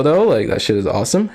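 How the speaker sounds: normal talking voice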